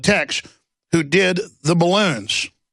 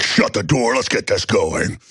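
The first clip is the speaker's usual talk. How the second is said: lower, slower conspiratorial voice; lightly sinister elite; 6 semitones lower than usual. Retold or imitated. A hint of a sinister billionaire boarding his jet, low and slow. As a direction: drop into a shady boss.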